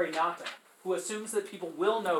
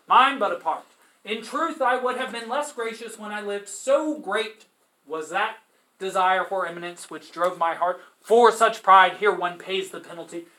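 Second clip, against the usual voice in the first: louder, earnest recitation; reading the poem aloud; 6 dB louder than usual. Acted. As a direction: speak the penitent's verse with weight. Reads Oderisi's speech.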